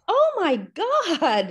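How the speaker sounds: high, slow, drawn-out oh my God; stunned gasp. Retold or imitated. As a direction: let the shock stretch each word. Secretary reacts to three boys.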